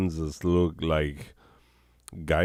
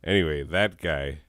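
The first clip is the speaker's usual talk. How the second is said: normal talking voice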